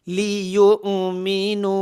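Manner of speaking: লিয়ুমিনু with its long vowel stretched three alif; long madd. Shown as a demonstration. Teacher models madd munfasil, slow chanted লিয়ুমিনু. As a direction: pull the vowel out slowly in chant. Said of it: "তিন আলিফ পরিমাণ টেনে পড়তে হবে".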